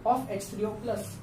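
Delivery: conjugate base of H three O plus, said slowly; teacher showing the correct phrasing; slow reading aloud. Shown as a demonstration. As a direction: model the sentence at half speed. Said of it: "ऐसे पढ़ा जाता है".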